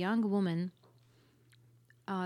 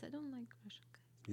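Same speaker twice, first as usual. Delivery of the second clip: whispered; 3 semitones higher, 10 dB quieter than usual. Real, not acted. Whispered confession.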